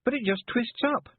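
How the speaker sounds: a high, mildly frustrated complaint; a girl whining that it won't work; a touch of complaint; complaining. Acted. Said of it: "Tiffany complained".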